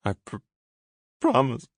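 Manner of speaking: stammering through tears; crying promise. A narrator's reading. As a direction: choke out the word. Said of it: "he cried".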